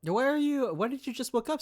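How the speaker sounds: ordinary talk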